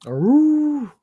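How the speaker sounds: high howling aroo; wolf howl. Pretend.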